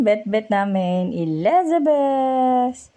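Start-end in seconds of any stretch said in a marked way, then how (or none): 1.1-2.9 praising a pet. Real, not acted. Owner praising Elizabeth for drinking, high baby talk.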